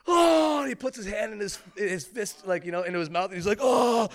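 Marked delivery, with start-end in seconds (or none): 0.0-0.6 loud, high mock wail; hammed-up overwhelmed "oh"; anguished cry. Imitates man overcome, fist in mouth. 3.6-4.1 loud, high mock wail; hammed-up overwhelmed "oh"; anguished cry. Imitates man overcome, fist in mouth.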